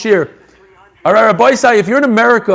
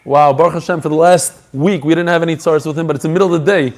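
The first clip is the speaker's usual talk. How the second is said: normal talking voice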